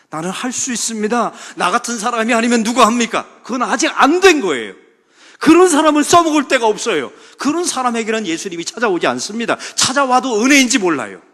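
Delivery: loud, high preaching that drives each point home; fiery preaching. Acted. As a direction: preach it to the back pews. Preaches at full force.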